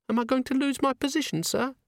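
put-on high, anxious young maid voice; worried maid. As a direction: ask fearfully as the maid. Frightened maid asks.

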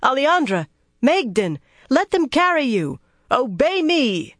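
high-pitched imperious call; calling out commands. A narrator's reading. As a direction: call each name, then command obedience. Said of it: "Fail called to them".

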